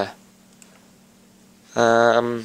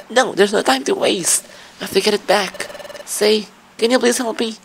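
put-on higher, pleading young character voice; higher distressed hero-partner voice; worried partner Pokemon; 5 semitones higher than usual. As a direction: beg for help, anxious and rushed. Reading partner dialogue aloud.

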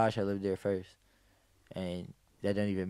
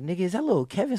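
higher, urgent disbelieving question; startled recognition; 8 semitones higher, faster than usual. Retold or imitated. Spotting his friend lying on the street, startled question. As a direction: catch your breath seeing him.

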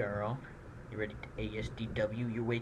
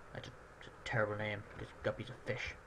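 fast, much softer whisper; whispered aside; 3 semitones lower, 11 dB quieter, faster than usual. Real, not acted. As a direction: whisper the joke to yourself. Whispered aside about the name.

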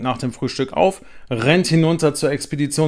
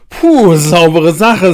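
relieved; 5 semitones higher, 12 dB louder, slower than usual. A narrator's reading. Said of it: "sagt Max".

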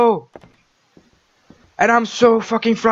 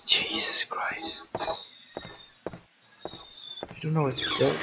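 hushed, slow, breathless whisper; scared whisper; 4 semitones lower, 15 dB quieter, slower than usual. Real, not acted. Gamer hiding from the ghost, whispering in fear. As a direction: whisper it as if she might hear you.

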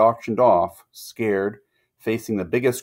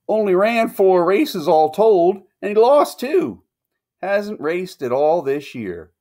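much higher, slow, loud groom; shrugging groom; 10 semitones higher, 4 dB louder than usual. A narrator's reading. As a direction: shrug it off in a high voice. Groom shrugs about races.

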